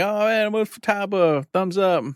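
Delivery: mock-giddy thumbs-up to a cosplayer; giddy fan. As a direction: gush like a starstruck fan posing for a photo. Imagined fan photo with cosplayer.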